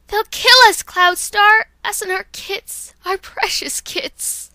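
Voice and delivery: panicked mother cat pleading; Dawn Flash terrified. Acted. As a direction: beg the leader to save the kits. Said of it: "here's Dawn Flash".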